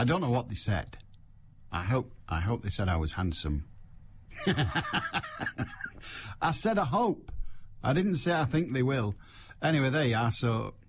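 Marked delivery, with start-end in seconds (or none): 4.3-6.4 high amused laugh. Real, not acted. Host laughing at his own vanity joke. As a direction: laughs at hoping they called him handsome.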